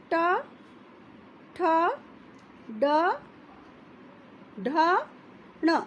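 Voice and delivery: ट, ठ, ड, ढ, ण with long pauses between; slow letter demo. Shown as a demonstration. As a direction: spell ट ठ ड ढ ण slowly and clearly. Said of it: "आप खुद उच्चारण करके देखिए". (मूर्धा से धीरे-धीरे उच्चारण)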